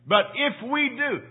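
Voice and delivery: if leaned on, voice lifted high; stress on if. Shown as a demonstration. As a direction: lean on the word if. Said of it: "I want to emphasize that word, if".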